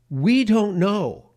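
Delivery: we drawn out high and loud; emphatic we. Real, not acted. Host stressing unknown numbers, slow and emphatic. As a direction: lean hard on we don't know. Emphatic point about border.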